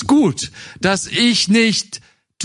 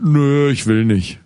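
stubborn son; 3 semitones lower than usual. Acted. Second son refuses in parable.

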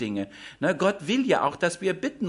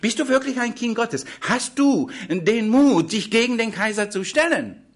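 higher and louder, needling each question; goading challenge; 7 semitones higher, 5 dB louder than usual. Acted. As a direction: press him hard, daring him. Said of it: "Darum sagte uns".

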